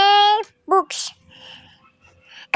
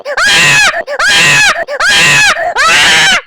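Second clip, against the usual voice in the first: high, loud frightened screaming; real screams; 13 semitones higher, 7 dB louder, much slower than usual. Real, not acted. Gamer caught by Grandpa, screaming in fright. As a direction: scream in panic.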